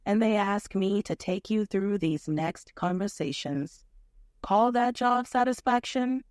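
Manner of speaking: low, gloomy robot drone; Marvin voice. Pretend. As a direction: sigh it out like a depressed android. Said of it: "my best attempt at channeling Marvin".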